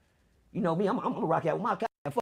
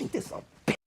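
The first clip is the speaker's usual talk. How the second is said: whispered under his breath; whispered aside. Retold or imitated. Caught in the police car, whispered aside to himself. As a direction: whisper it in disbelief.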